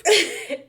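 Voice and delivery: loud, high burst of laughter; real laugh. Real, not acted. Laughing to viewers about her swollen lips, loud laugh. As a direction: laugh out at the memory.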